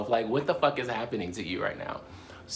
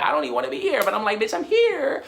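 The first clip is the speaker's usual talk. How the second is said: high, louder mock exasperation; exasperated high voice; 12 semitones higher, 4 dB louder than usual. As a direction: squeal it out, fed up. Retelling own exasperated inner voice.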